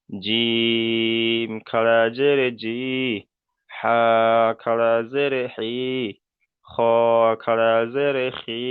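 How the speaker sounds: final "ই, বি, তি" each held long; slow chant-like spelling, stretching "জিম", "দাল" and the closing "দি"; drawn-out letter drill. Shown as a demonstration. Teacher showing khara zer to pupils, long "ই". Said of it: "টান কিন্তু এক আলিফ পরিমাণ করে".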